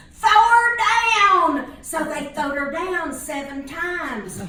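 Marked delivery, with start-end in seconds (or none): 0.1-1.8 high, loud, stretched-out holler. Retold or imitated. Fella hollering up to the Philistines, a long loud holler. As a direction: bellow it up to the Philistines. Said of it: "he hollered up to the Philistines".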